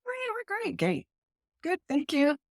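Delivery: high, small voice pretending all is fine; falsely cheery. Flour-covered baker reassuring the chef, high and falsely cheery. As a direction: squeak out a mock-cheerful reassurance.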